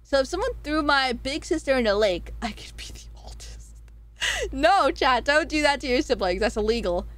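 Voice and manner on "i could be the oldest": whispered mock-scheming aside; conspiratorial whisper. Streamer plotting aside to chat about becoming the oldest, whispered. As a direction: drop to a hushed, unvoiced whisper.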